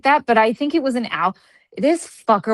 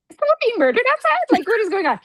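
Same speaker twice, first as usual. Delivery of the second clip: high, fast panicked question; mock alarm; 12 semitones higher, much faster than usual. Wife replaying her reaction to a night noise, high-pitched alarm. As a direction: blurt it out high, acting alarmed.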